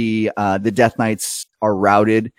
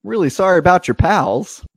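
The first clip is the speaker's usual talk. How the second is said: higher, light aw-shucks condolence; hint of a folksy driver's pity; folksy sympathy; 7 semitones higher than usual. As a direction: offer a breezy sorry. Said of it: "he goes".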